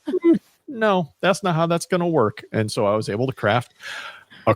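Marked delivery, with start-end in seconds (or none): 0.1-2.4 lightly voiced higher-pitched wife; Missus Advice. Husband quotes his wife correcting him, lightly imitated in higher pitch. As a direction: mimic a wife's gentle veto, pitched up. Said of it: "I'm married to Missus Advice, who was able to say".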